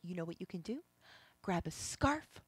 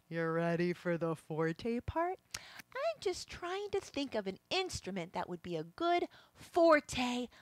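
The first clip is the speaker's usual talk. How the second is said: ordinary talk, nothing marked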